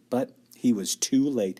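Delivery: ordinary delivery, nothing marked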